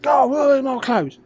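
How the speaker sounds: high, loud, slow indignant cry; loud drawn-out outrage; storming yell. Retold or imitated. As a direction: storm up bellowing for the clothes. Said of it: "storming up".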